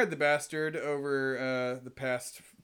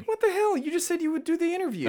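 whiny protest, pitched way up; high-pitched whine; 7 dB louder than usual. Acted. Producer protests host quitting the interview, high-pitched whine. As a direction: squeal it in whiny disbelief. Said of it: "calm your high-pitched, whiny voice down".

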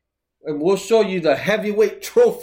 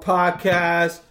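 ordinary delivery

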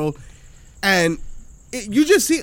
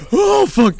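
high, louder scared cry; panicked yelp; 12 semitones higher, 7 dB louder than usual. Retold or imitated. Every drop of the ride, high scared yelp. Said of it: "I'm like".

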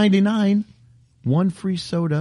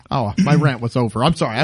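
ordinary talk, nothing marked

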